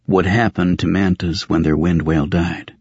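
ordinary delivery

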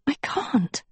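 high, urgent little whisper. Acted. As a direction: whisper it as a secret refusal. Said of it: "Sophie whispered".

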